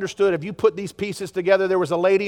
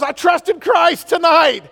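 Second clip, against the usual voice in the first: high, loud, slow excited shout; a girl telling her pastor she was saved; 8 semitones higher, 4 dB louder, slower than usual. Retold or imitated. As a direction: burst out with joyful news. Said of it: "She goes".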